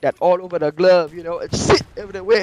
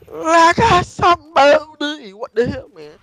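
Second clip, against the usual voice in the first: high, loud, drawn-out mocking repeat of their words; mocking echo; 10 semitones higher, 8 dB louder, slower than usual. Speaker mocking people who ask about map packs, sarcastic. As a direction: throw their own line back at them, slowly and sarcastically.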